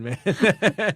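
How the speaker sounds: ordinary talk, nothing marked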